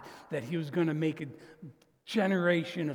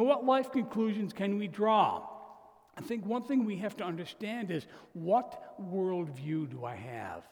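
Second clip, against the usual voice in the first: ordinary delivery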